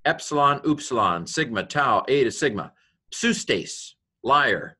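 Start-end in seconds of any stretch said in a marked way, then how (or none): none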